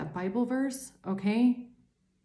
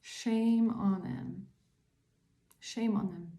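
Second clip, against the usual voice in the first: slow fading; 4 dB quieter, slower than usual. Real, not acted. Slow disgusted fading repetition.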